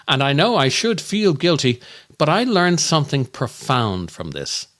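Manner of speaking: normal talking voice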